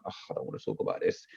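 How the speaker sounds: low, quick groan of reluctance, re-enacted; weary reluctance. Husband refusing wife's fear talk, weary acted groan. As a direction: grumble it out, dropped right down in pitch. Retelling his refusal to wife.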